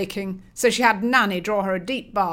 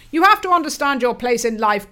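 1 loud lecturing father; 4 semitones higher, 7 dB louder than usual. Acted.

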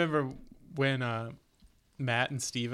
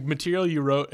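ordinary delivery

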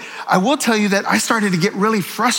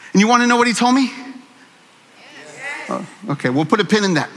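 ordinary talk, nothing marked

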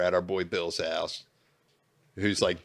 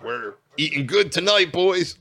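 loud, high gloating call; gleeful boast; 9 semitones higher, 8 dB louder than usual. Retold or imitated. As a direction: crow it like a feast is coming. Excited boast quoting himself.

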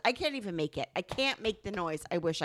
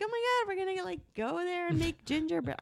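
put-on much higher, giddy little-girl voice; 9 semitones higher than usual. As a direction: skip through the woods, delighted, pitched way up.

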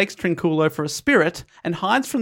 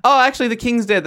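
high, loud announcement; returning bearer of news; 7 semitones higher, 4 dB louder than usual. Retold or imitated. Returning survivor announcing the king's death, high and loud. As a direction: break the news, pitched well up. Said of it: "you turn up and you go".

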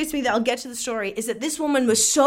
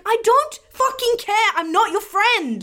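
high, slow, exasperated mock outburst; retold angry retort; 10 semitones higher, slower than usual. Seller replying to complaining buyer, exasperated mock anger. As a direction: lean on each word, pitched up and fed up.

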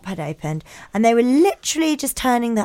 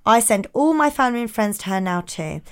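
ordinary delivery

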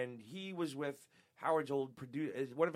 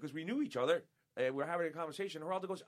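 normal talking voice

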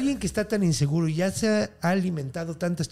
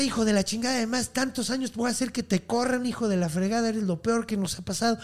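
retold angry yell; 8 semitones higher than usual. Woman berating Julián after catching him spying, mock angry yell. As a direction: imitate an outraged telling-off. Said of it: "le grita".

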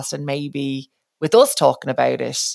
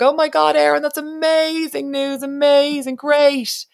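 excited nanny; 12 semitones higher, 3 dB louder than usual. Retold or imitated. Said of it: "She was like".

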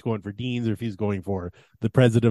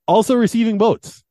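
higher, louder announcement; mock list announcer; 8 semitones higher, 7 dB louder than usual. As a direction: announce the runners-up like an awards host.

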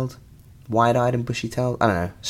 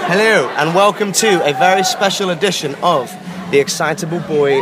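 loud, high shouting over roller coaster noise; shouting over the ride; 7 semitones higher, 3 dB louder than usual. Real, not acted. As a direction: yell every word over the wind. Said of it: "You can hear me screaming for my life".

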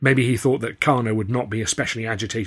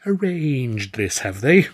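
higher, slow, struggling to get it out; strained fluster; 8 semitones higher, 5 dB louder, slower than usual. Acted. Arthur managing a question while being seduced. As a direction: squeeze the words out flustered. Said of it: "I managed to say".

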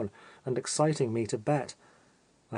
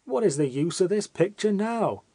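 a touch of frantic exclamation; lightly voiced outburst of a frenzied man; mild exclaiming; 5 semitones higher than usual. A narrator's reading. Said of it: "exclaiming from time to time".

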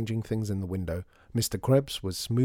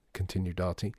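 ordinary delivery, nothing marked

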